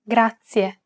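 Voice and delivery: "grazie" with a rolled R, GRAH-tsee-eh; Italian pronunciation. Shown as a demonstration. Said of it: "It's pronounced".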